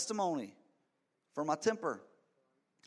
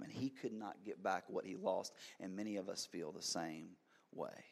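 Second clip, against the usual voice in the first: normal talking voice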